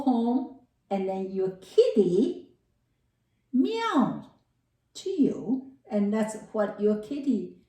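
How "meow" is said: high little cat meow; a cat mewing at its owner, high and cute. Pretend. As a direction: mew like a kitty. Kitty meow imitated.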